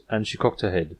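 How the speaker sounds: ordinary delivery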